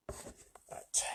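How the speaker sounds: a quick whispered aside. Real, not acted. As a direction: breathe it without voice.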